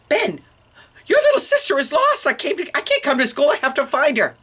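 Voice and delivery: high-pitched panicked rush of worry; frantic mother. Acted. Frantic mother thinks baby is lost.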